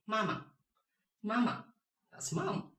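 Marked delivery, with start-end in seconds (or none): 0.1-1.7 "mama" high first syllable, short light second; first plus neutral tone. Shown as a demonstration. Teacher models the word for mom, high ma then slight neutral ma. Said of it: "And then neutral".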